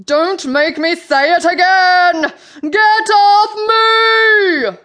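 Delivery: slow, high, building yell; Sea Queen's furious roar. A narrator's reading. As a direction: build to a long, loud roar. Angry queen repeats her roared order.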